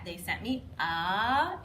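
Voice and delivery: slow, stretched They sent me a; emphasized suspense. Shown as a demonstration. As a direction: drag out me a for suspense. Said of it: "each time we kinda emphasize that".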